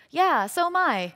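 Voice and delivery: excited. Retold or imitated. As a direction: reveal it with joy. Retold delighted reply to coworker.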